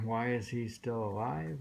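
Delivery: usual speaking voice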